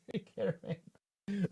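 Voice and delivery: slow, drawn-out mock farewell; imitating a viewer. Reading a viewer's goodbye to the stream, slow mock voice. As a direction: stretch it out like reading his message. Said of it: "it's boy Ed saying".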